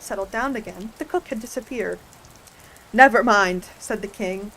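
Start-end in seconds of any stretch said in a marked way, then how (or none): 2.9-3.7 firm burst of relief; great relief. A narrator's reading. King after the cook has disappeared, relieved. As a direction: wave it off loudly, relieved. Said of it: "with an air of great relief".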